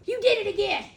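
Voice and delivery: high, loud "You did it again"; accusing. Retold or imitated. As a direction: confront him. Accusation repeated.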